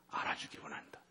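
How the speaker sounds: soft whisper of God's longing. Acted. Preacher voicing God to the congregation, soft whisper. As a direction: breathe the words like a secret. God's words quoted in sermon.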